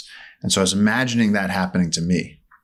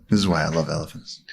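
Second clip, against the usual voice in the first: ordinary talk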